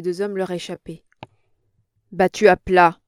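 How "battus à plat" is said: loud, furious retort; a hard furious shout; pale with fury. A narrator's reading. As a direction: spit it out in anger. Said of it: "tout pâle de fureur".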